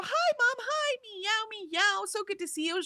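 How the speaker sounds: cat voice. Retold or imitated. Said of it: "Brady will come up to me and go".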